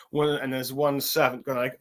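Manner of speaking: ordinary talk, nothing marked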